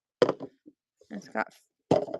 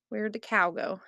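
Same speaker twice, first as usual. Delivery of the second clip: ordinary talk, nothing marked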